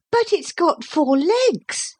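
put-on high, slow girl's amazement; astonished girl. A narrator's reading. Lucy spotting the flying creature, high and amazed. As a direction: pitch it right up in wonder.

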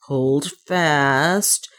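drawn-out command through set teeth. A narrator's reading. Man bracing his companion to slide, through set teeth. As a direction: force the words through clenched teeth. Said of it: "said Leonard through his set teeth".

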